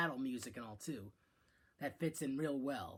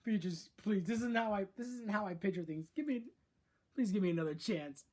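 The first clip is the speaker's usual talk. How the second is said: pleading Bowser voice, a bit higher; begging an ex back; 5 semitones higher, 3 dB louder than usual. Retold or imitated. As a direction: beg her to take you back. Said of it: "And he's like".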